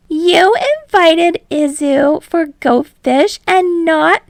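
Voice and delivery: high, loud mock wail, slowing to a drag at the end; hammed-up anguish. Mina crying out at friends who left her out of Go Fish, hammed-up wail. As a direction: whine like the world is ending over a card game. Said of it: "Mina let out a cry of anguish".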